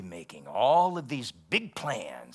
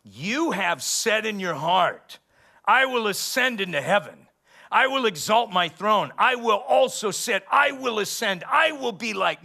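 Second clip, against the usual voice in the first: much higher, louder proud boasts; Satan boasting; 9 semitones higher, 9 dB louder than usual. Retold or imitated. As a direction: swagger through each "I will" like the arrogant devil. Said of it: "the Lord said of Satan".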